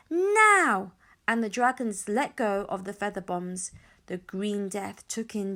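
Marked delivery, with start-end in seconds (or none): 0.1-0.9 high, loud drawn-out shout; shouted command. A narrator's reading. Hiccup signalling the dragons to drop bombs, shouted. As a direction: call the signal to attack. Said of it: "Hiccup shouted".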